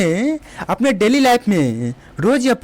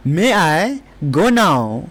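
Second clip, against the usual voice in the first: very slow, word by word; slow teacher demo; slow model sentence; 4 semitones lower, much slower than usual. Shown as a demonstration. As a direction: stretch it out so students can copy. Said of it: "क्या मैं अब जा सकता हूँ?".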